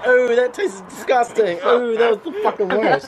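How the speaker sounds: high disgusted groan with a laugh. Real, not acted. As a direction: recoil from the taste, laughing. Reacting to dog food bean.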